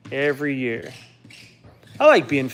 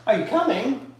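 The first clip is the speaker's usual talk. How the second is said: calling the dog; 8 semitones higher than usual. Real, not acted. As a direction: coax the dog down, voice up high.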